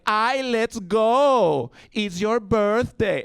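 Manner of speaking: very slow, high Colombian lilt; Colombian sing-song. Retold or imitated. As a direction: stretch it like the Colombian family. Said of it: "everyone was like".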